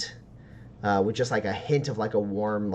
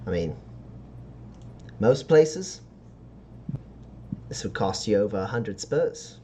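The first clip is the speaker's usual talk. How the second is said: halting sales pitch with long pauses; sly dealer's pitch; 4 dB quieter than usual. Acted. Dealer pricing rare stardust for a customer, lightly voiced sly pitch. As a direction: draw out the pitch before naming the price.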